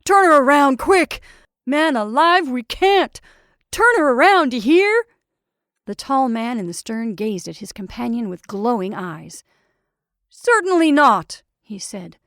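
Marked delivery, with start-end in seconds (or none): 0.0-5.0 frantic, high shouted orders; roaring. A narrator's reading. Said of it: "he roared". 10.3-11.4 slow, loud, raised-pitch refusal; firm refusal. A narrator's reading. Firm refusal in heated quarrel.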